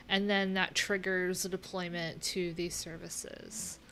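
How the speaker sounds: usual speaking voice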